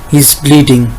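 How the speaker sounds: ordinary delivery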